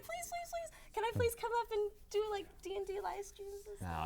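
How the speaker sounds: high, soft pleading voice; imitated begging. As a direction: re-enact begging in a small, very high voice. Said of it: "I know I begged for it".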